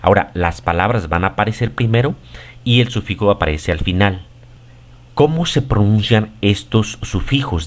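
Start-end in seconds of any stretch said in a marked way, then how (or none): none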